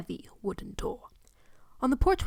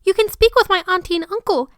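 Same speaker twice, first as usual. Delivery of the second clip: put-on much higher and louder, a bright child voice; polite little boy; 10 semitones higher, 10 dB louder than usual. Boy pointing the visitor to his aunt, polite high child voice. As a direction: chirp it like a well-mannered kid. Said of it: "Harry retorted politely".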